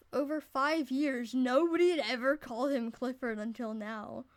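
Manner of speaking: usual speaking voice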